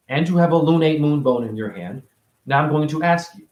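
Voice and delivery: normal talking voice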